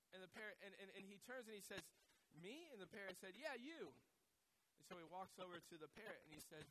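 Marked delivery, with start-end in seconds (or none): 2.3-2.7 high, questioning pitch; mildly acted bewilderment, voice lifted; puzzled shopper. Shopper answering an unseen caller, lightly acted puzzlement. 3.4-3.9 high-pitched parrot retort; insistent parrot. Acted. Parrot singling out the puzzled shopper, high and insistent.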